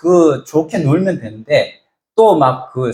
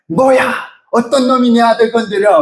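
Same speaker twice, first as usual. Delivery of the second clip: mother's fierce, raised shout; angry mother; 7 semitones higher than usual. Retold or imitated. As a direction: hurl it like a furious mom. Angry mother defends son. (아들을 지키려는 엄마의 성난 외침)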